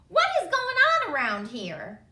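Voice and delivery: high, loud whiny complaint; hammed-up storybook exasperation; exasperated groan. A narrator's reading. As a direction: complain like a fed-up little girl. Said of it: "groaned the exhausted girl".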